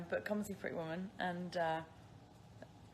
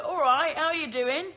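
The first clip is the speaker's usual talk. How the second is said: higher, louder imitation British greeting; mock British accent; 7 semitones higher, 9 dB louder than usual. Mimicking how people greet her, mock British accent. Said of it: "people go".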